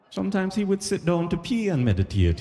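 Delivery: high-pitched put-on guide voice; Jamaican tour guide. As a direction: explain it like an earnest tour guide. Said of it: "So they'd be like".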